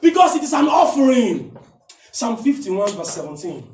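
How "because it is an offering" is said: loud, higher, slow emphatic sermon; preaching at full force. Acted. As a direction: drive it home with fire.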